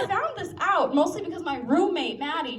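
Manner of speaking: usual speaking voice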